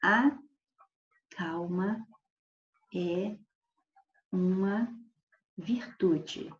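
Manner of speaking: word by word, with long pauses; slow statement. Shown as a demonstration. Teacher slowly reads calma used as a noun. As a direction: lay out each word calmly as a plain fact. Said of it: "se eu disser assim, ó".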